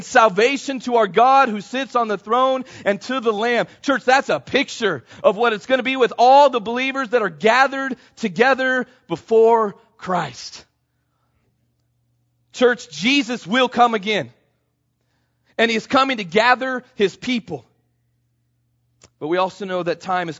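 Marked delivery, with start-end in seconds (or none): 0.0-3.7 higher, louder proclamation of scripture; loud cry of praise. Acted. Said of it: "they cry out with a loud voice". 3.8-17.6 high, slowing, emphatic sermon climax; fervent preaching. Real, not acted. Preaches the point to the church.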